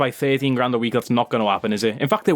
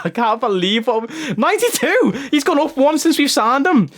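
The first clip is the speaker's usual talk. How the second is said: much higher, laughing through the words; laughing disbelief; 8 semitones higher, slower than usual. Real, not acted. Streamer laughing at regen's rating jump, laughing. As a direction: crack up at the ridiculous rating. Stat jumps after signing, laughs.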